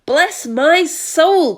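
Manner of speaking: very slow, high, loud amazement; astonished cry. A narrator's reading. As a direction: gasp it out in disbelief. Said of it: "cried the head of the fire department".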